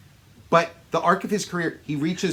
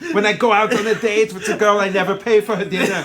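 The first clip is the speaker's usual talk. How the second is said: higher, louder mock Austrian accent; Schwarzenegger; 5 semitones higher, 7 dB louder, faster than usual. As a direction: play it big with a comic Austrian accent. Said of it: "he talks the way he does".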